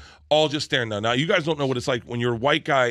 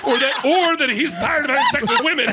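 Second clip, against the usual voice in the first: laughing delivery; 11 semitones higher, 5 dB louder than usual. Retold or imitated. Dad's punchline, teller laughing.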